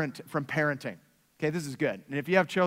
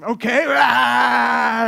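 mock yell; 3 semitones higher, 8 dB louder, much slower than usual. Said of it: "you start acting like them".

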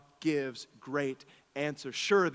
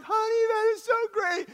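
put-on much higher, gushing to a child; sweet parent voice; 3 dB louder than usual. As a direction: gush at your winning daughter. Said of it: "and I'm like".

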